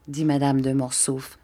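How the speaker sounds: plain reading voice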